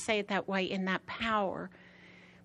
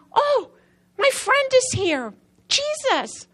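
much higher, louder cry of joy; ecstatic greeting; 14 semitones higher, 9 dB louder, slower than usual. Retold or imitated. Preacher recalls greeting Jesus, ecstatic and loud. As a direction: call out to a dear friend in delight.

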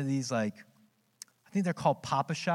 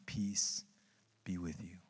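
soft, lower, tender words of peace; gentle blessing; 10 semitones lower, 13 dB quieter, slower than usual. Retold or imitated. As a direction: offer peace quietly, like a blessing. Jesus greets doubting Thomas.

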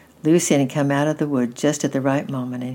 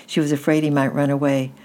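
ordinary delivery, nothing marked

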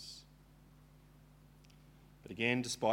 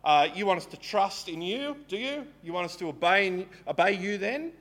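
higher, louder sarcastic challenge; mocking Israelites; 5 semitones higher, 6 dB louder than usual. Israelites taunting God to give them water, mocking challenge. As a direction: taunt God, demanding and cheeky. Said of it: "they're saying".

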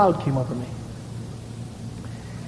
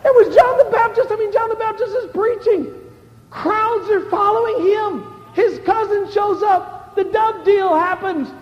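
preaching at full force; 10 semitones higher, slower than usual. Acted. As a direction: drive the story up to the crowd. Preacher retelling John's story.

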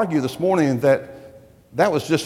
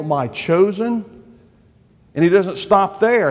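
louder, emphatic proclamation of God's words; forceful preaching; 2 semitones higher, 5 dB louder than usual. Acted. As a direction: drive the promises home like a preacher.

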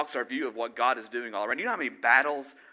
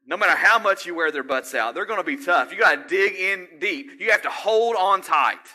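loud, raised-pitch exhortation; impassioned preaching shout; 6 semitones higher, 10 dB louder than usual. Real, not acted. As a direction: preach it hard, urging them to dig in. Fervent exhortation to hold on.

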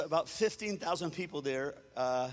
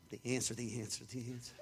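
lower, fading repeated mumble; stalling mumble; 7 semitones lower, 9 dB quieter, faster than usual. Retold or imitated. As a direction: stall for time, trailing off. Stalling for an answer on stage.

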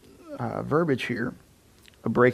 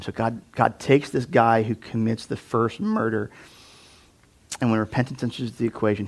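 usual speaking voice

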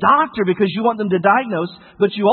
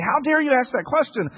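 mildly indignant parent, pitched up; mock scolding; 5 semitones higher than usual. Parent reacting in fear to a child's question, mock scolding. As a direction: imitate a parent's scolding without shouting. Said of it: "we can scold them".

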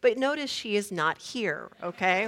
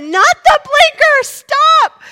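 very high, loud frantic correction; panicked yell; 14 dB louder, slower than usual. Retold or imitated. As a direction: yell it at a learner hitting the wrong control. Panicked passenger yelling at learner driver.

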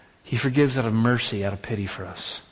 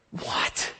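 a whispered, incredulous what; stunned disbelief; 7 dB quieter than usual. Real, not acted. Preacher reacting to the unforgiving servant, whispered disbelief. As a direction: let the shock steal your voice.

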